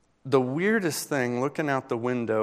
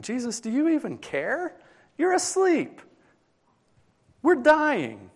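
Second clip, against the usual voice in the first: high, desperate, panicked shout; panicked cry; 13 semitones higher, 3 dB louder than usual. Acted. Frightened disciples waking Jesus in the storm, with a high panicked cry. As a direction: scream it to wake him as the boat sinks.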